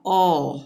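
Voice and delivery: final ओ held as an open "aw"; open aw sound. Shown as a demonstration. Teacher confirming OA before R, ओ as open "aw". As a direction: say the last ओ as a long "aw". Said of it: "उसका उच्चारण हो जाएगा".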